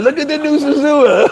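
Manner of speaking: high, excited mocking promo voice; mock hype. Mocking the government's social media posts with fake hype.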